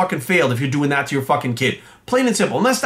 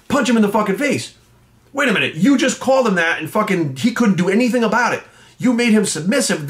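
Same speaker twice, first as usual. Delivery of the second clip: ordinary talk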